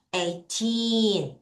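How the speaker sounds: put-on Eighteen with a long, strong TEEN; eigh-TEEEN, ending held; stress on TEEN. Shown as a demonstration. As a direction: stretch the second syllable of Eighteen. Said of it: "틴을 강하게 읽겠습니다".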